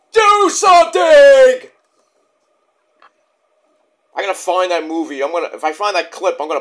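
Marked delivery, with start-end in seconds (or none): 0.1-1.7 much higher, much louder, drawn-out slow scream; desperate scream. Retold or imitated. Vlogger quoting a movie, peak desperate scream. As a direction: stretch the last plea into a full scream. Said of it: "where that movie comes from".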